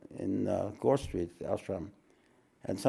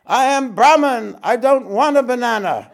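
shouting from far off; 10 semitones higher, 12 dB louder than usual. Retold or imitated. Disciple refusing the banana from a distance, ecstatic shout. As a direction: call it out from across the ashram. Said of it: "off in the distance".

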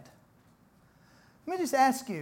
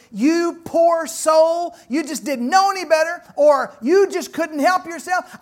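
higher, louder, slow pitying tone; pitying God voice; 7 semitones higher, 7 dB louder, slower than usual. Retold or imitated. Preacher voicing God's pity for a sinner, tender. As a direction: speak as God pitying a poor soul.

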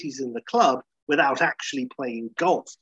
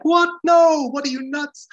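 much higher, louder incredulous squeak; Larry David impression; 8 dB louder than usual. Larry refusing the pimp, in a high incredulous squeak. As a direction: squeal in disbelief. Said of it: "And Larry's like".

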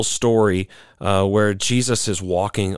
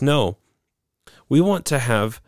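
ordinary talk

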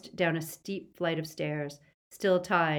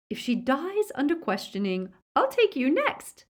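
high, taunting threat rising louder; menacing threat; 9 semitones higher, 5 dB louder than usual. A narrator's reading. Witch warns boy he is next, high menacing threat. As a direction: climb in pitch toward the threat.

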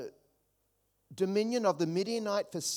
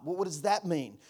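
usual speaking voice

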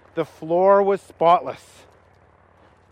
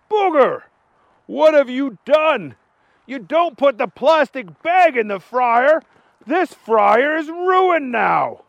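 higher, exasperated boss voice; scolding manager; 6 semitones higher, 3 dB louder than usual. Acted. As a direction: scold the clumsy new cook. Manager scolds Booger's mistake.

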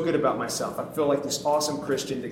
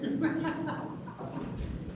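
breathy amused laugh; 14 semitones higher, 11 dB quieter than usual. Real, not acted. Preacher admitting the story has no application, laughing. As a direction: laugh it off softly.

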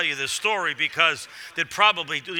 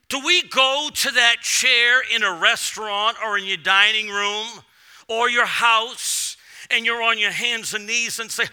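ordinary delivery

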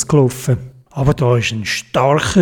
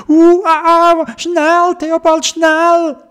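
much higher squawking, anxious; nervous Dodo; 14 semitones higher, much slower than usual. A narrator's reading. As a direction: flap and fret like a worried bird. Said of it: "ein bisschen nervös geworden". (nervöser, hoher Vogelruf)

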